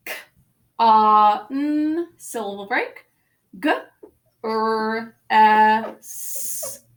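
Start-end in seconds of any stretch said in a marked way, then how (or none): 0.1-2.1 "K, ah, n" as separate phonemes, ah held loud; phonics-teacher "K, ah, n" with long gaps; single sounds. Shown as a demonstration. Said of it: "let's go over the spelling together". 3.6-6.8 "g, r, s" as separate held phonemes; phonics-teacher "g, r, s" with long gaps; single sounds. Shown as a demonstration. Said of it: "let's go over the spelling together".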